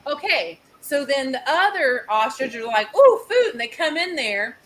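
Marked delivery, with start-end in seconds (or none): 2.9-3.5 high, loud eager yelp; excited animal voice. Retold or imitated. Storyteller voicing ostriches spotting food, excited. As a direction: pipe up like a greedy bird.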